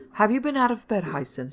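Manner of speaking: questioning mother, starting high and loud; suspicious. A narrator's reading. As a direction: probe with mild suspicion. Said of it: "she asked suspiciously".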